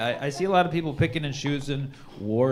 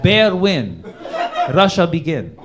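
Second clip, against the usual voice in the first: mock Putin screenplay; 2 semitones higher, 4 dB louder than usual. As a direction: read it like a grand epic in clipped broken English. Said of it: "He's like".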